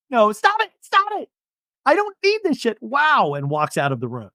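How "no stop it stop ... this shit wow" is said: high, hammed-up outraged Shannon; mock angry Shannon. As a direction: storm off like Shannon. Imitating Shannon storming off.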